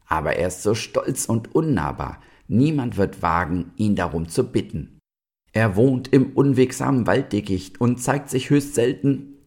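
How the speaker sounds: hint at an owl's croak. A narrator's reading. Owl warns the animals about the proud stag, mildly croaking. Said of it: "krächzte die Eule".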